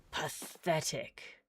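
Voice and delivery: scorn it like a cold stranger; sneering figure. Acted.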